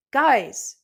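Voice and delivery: loud, high plea to stop; intervening. Acted. Usopp trying to stop the fight, loud plea. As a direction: jump in to calm them. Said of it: "Usopp tried to intervene".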